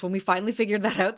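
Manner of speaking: usual speaking voice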